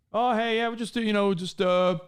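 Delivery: lightly voiced chatty cop; a casual, friendly traffic stop chat. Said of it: "small talking, like, friendly".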